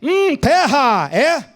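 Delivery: high, slow drawn-out mock rapture; mock ecstatic worship. Pastor mocking worship only felt in church, slow mock rapture. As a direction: parody worship lost in ecstasy. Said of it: "Quando eu tô aqui".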